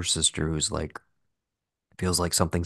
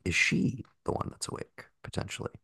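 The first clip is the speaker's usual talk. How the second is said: hushed, breathy wondering; whispering; 5 semitones higher, 7 dB quieter than usual. Retold or imitated. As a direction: whisper the question to yourself. Wondering aloud in a whispered quote.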